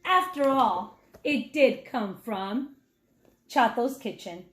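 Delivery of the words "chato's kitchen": ordinary delivery, nothing marked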